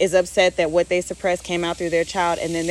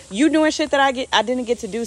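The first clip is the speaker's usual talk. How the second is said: excited parent; 6 semitones higher, faster than usual. Retold or imitated. Parent realising the child lives what they couldn't, excited.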